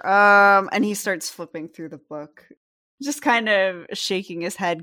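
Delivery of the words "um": ordinary talk, nothing marked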